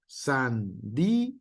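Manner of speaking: sandía said slowly, stressed í split from a; slow demo. Shown as a demonstration. Teacher giving an example word to the class, slowly. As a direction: say san-dí-a slowly. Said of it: "Por ejemplo".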